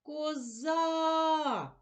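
Коза with the final а held long; Козааа, drawn out to bring out the а; stretched last vowel. Shown as a demonstration. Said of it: "Чуємо звук А".

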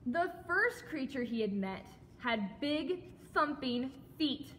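ordinary delivery

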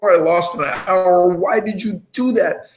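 high, tense retold anger at his girlfriend; angry accusation. Carpenter snapping at his girlfriend over the tape measure, angry. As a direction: fire off questions like a fed-up man.

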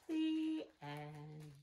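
lively, sing-song storybook reading; animated picture-book narration with slow hushed refrains; reading to children. A narrator's reading. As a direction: read it like storytime for little ones. Reads picture book aloud.

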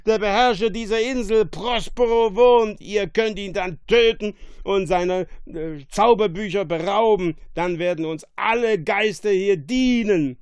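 loud, high rabble-rousing; excited shouting. A narrator's reading. Drunk monster inciting two castaways to revolt, shouting. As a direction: urge them on at full voice. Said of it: "schrie er und begann".

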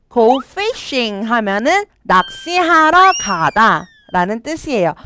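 ordinary talk, nothing marked

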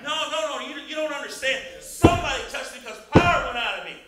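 forceful pulpit storytelling, high and loud; emphatic preaching. Acted. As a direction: press the point hard, acting out Jesus.

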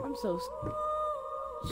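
high, soft, drawn-out fear; scared whimper. Real, not acted. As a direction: whimper it out slowly. Scared, trailing off.

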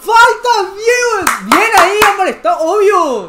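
shouted protest. Real, not acted. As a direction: yell for the foul. Protests a foul watching match. (protesta a gritos)

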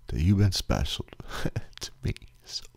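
real tears fading into a whisper; crying whisper. Real, not acted. Vlogger telling listeners they were special, crying softly. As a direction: let the words dissolve as you cry. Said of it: "I feel stupid for crying".

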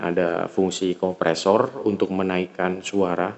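usual speaking voice

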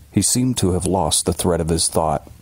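plain reading voice

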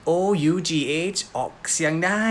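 usual speaking voice

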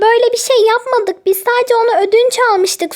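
usual speaking voice

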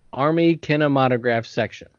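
usual speaking voice

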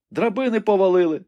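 high, tense shaky answer; trembling. A narrator's reading. Rebecca answers Ivanhoe trembling about the ladders. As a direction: answer with a tremor. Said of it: "тремтячи відповіла Ребекка".

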